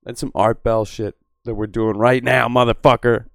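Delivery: loud, booming late-night radio rant; loud radio host bit. Acted. Streamer to chat, booming like a radio host.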